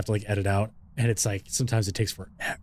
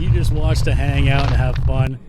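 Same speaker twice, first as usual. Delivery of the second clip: loud, upbeat address to viewers; 10 dB louder than usual. Real, not acted. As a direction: say it loudly to the audience.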